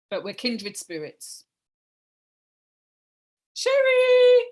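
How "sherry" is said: loud high call across the room. Real, not acted. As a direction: call her name loudly. Host calling out for guest.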